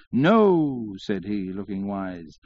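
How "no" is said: high, long falling drawl; drawling syllables. A narrator's reading. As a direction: draw the refusal out wisely. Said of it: "drawling out his syllables".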